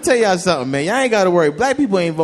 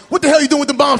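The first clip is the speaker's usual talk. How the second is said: lightly voiced tough guy, a bit louder; Mister T voice; 8 semitones higher, 4 dB louder than usual. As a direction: bark it like Mister T. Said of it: "He'll bust in there".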